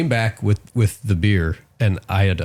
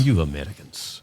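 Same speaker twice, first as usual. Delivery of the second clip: low, slow mock Russian; Russian accent; 5 semitones lower, 4 dB quieter than usual. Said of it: "try to do a Russian accent".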